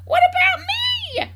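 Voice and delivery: high, loud, indignant screech; grandma shouting. A narrator's reading. Grandma on the roof jealous of the hen, shouting. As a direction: holler it very high, dragging out the end. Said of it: "Grandma shouted from the rooftop".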